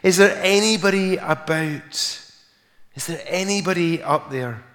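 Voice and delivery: high, retold call up the gully; crying out for rescue. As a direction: act out calling for anyone above, fading slightly. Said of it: "He cried out".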